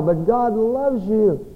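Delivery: slow, gentle, intimate; tender assurance. Real, not acted. Preacher to struggling listeners, slow tender reassurance. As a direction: lean in and slowly reassure the hurting listener.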